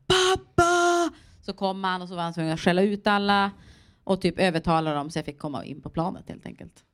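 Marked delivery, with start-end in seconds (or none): 0.1-1.1 high, loud call across the queue; panicked shout of Pappa; shouting for dad. Retold or imitated. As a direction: yell for your father, high and loud. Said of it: "det enda jag skrek bara".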